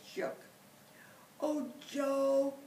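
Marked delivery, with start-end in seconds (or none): 1.4-2.6 loud high grateful howl. Acted. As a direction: howl his name with joy. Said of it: "the bear howled".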